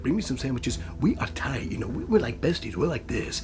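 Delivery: low, soft, casual mammoth voice; deep, quiet, offhand character voice; Will Arnett. Acted. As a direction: drop deep and chummy, chatting quickly like a buddy. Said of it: "like, a Will Arnett territory".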